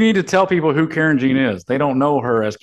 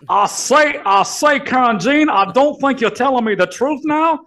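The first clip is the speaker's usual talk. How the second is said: southern Confederate voice; 11 semitones higher than usual. Acted. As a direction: bluster like an old Confederate southerner. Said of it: "Southern racist, uh, Confederate voice".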